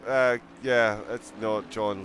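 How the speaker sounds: usual speaking voice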